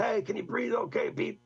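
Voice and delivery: high called-out question toward the trunk; calling out. Retold or imitated. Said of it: "Jack's yelling".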